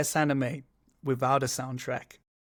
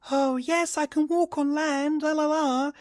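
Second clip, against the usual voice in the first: much higher, dainty, slow sing-song; English Ariel; 3 dB louder than usual. Retold or imitated. Imitating Ariel in the English version, high dainty sing-song. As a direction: trill it like a dreamy mermaid. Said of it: "Ariel's like".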